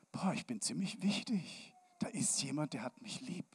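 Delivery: hushed, amazed whisper; awed whisper. Acted. Child realizing it is loved, whispered in awe. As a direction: breathe it out in wonder. Inner thought of being loved.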